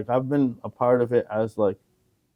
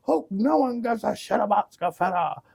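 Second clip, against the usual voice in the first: imitated screaming; 11 semitones higher than usual. Friend showing how the dude screamed on the mic, high mock screaming. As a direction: screech it like a wasted heckler, without real volume. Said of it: "that dude was screaming".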